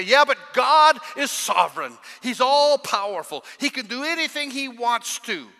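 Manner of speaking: mimicking a churchgoer who insists God can do anything; mock objector. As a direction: shout the objection like a heckler. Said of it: "I hear somebody say".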